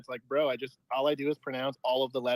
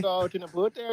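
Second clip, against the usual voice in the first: higher mock Maritimes voice; East Coast Canadian; 4 semitones higher than usual. Caller plays a Maritimer, East Coast Canadian accent. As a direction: playfully exaggerate the East Coast Canadian vowels. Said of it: "like, the Maritimes".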